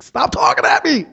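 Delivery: high-pitched mock plea to be left alone; exasperated outburst. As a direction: burst out as if at wit's end. Acts his exasperated plea to her.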